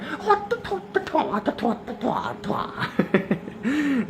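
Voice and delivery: long, high, breathless cackle; high cackling laugh. Real, not acted. Vlogger cracking up at her own predator joke, cackling. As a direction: lose it completely laughing.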